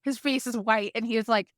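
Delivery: ordinary talk, nothing marked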